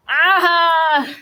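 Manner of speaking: a sudden pained "Ah!"; cry of pain. A narrator's reading. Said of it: "Salvatore cried out in pain".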